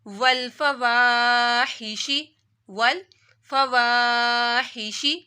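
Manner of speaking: وَالْفَوَاحِشِ slowly, the alif after واو drawn out; slow recitation of وَالْفَوَاحِشِ with held alif; alif madd. Shown as a demonstration. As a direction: stretch the long aa of وَالْفَوَاحِشِ one beat. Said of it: "زبر آ رہی ہے تو الف مدہ".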